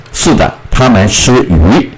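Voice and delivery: normal talking voice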